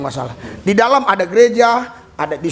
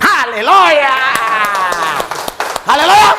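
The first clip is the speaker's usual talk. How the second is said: each hallelujah higher and louder, building; 5 semitones higher, 4 dB louder than usual. Real, not acted. Preacher rousing the congregation, shouting hallelujah louder each time. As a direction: lift the crowd with rising praise.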